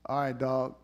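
a hint of a calm Jesus. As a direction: play Jesus calmly foretelling. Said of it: "Jesus said".

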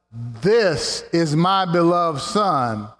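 each word drawn out slowly, weighted; slow reverent declaration; slow solemn emphasis. Acted. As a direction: lay it down slowly like a proclamation. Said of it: "I missed this for years".

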